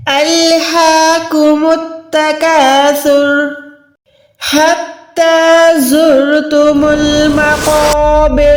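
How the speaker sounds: slow, measured tajweed recitation; slow Quranic recitation. Shown as a demonstration. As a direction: chant it slowly with tajweed. Recites verses in the lesson.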